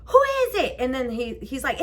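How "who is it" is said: high sing-out through the door; calling out. Retold or imitated. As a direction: call to whoever is knocking. Calling through her front door.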